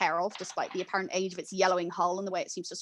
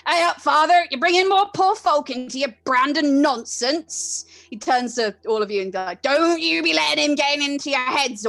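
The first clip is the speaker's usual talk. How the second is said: put-on loud, higher, rowdy old seadog jeering; rowdy old sailor voice, louder and higher; ragged old sailor; 5 semitones higher, 6 dB louder than usual. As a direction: heckle the priest like a boozy old sailor. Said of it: "a voice ragged with decades of cigar smoke".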